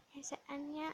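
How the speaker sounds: almost under the breath. Shown as a demonstration. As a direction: drop it to a hush. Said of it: "repeated softer". (พูดซ้ำเบาๆ เกือบกระซิบ)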